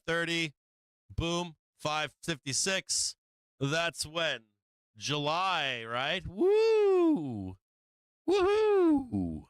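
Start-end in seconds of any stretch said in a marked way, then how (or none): 6.3-9.4 high, loud whooping cheer; gleeful whoop. Real, not acted. Trader whooping at his screen, high and loud. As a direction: whoop it up like a win just landed.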